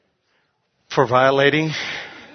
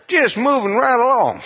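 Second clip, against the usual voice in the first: carefree driver; 9 semitones higher than usual. Retold or imitated. Retelling his carefree speeding.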